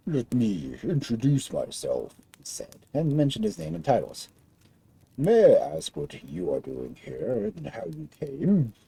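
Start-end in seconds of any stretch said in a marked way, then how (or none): 0.1-2.1 deliberate, slow giant; lightly voiced big giant. Giant speaks in story. 5.2-8.7 deliberate, slow giant; lightly voiced big giant. Giant questions princess.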